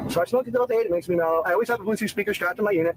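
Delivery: ordinary talk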